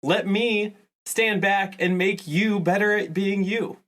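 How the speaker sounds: ordinary delivery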